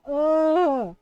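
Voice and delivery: loud, drawn-out "ugh"; disgusted groan. Real, not acted. Disgusted at coughing coworker.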